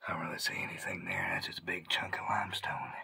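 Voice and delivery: quick hushed whisper. Real, not acted. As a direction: whisper it low. Whispering in cave.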